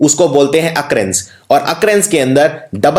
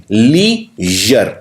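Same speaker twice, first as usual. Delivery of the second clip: Leisure stretched very slowly, every sound held; slow careful pronunciation; 4 semitones lower, much slower than usual. Shown as a demonstration. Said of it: "दोनों लिखने हैं L E आई".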